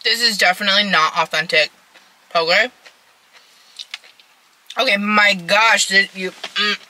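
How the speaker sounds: chewing while talking; speaking through a mouthful; mouth full. Real, not acted. Seen 1: Bite taken just before; chewing while talking, then sets bowl aside.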